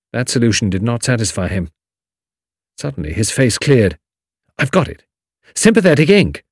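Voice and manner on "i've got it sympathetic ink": sudden realization. A narrator's reading. Tommy's face clears.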